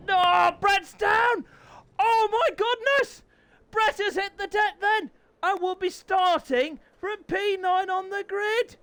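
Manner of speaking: high, shouted disbelief; voice-breaking commentary. Real, not acted. Commentator seeing Brett hit the deck, shrieking in shock. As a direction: cry out as the rider crashes. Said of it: "My voice has broken!".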